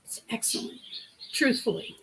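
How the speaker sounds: breathy hushed aside, almost no voice; whispered aside. Real, not acted. Host whispering to viewers about the show.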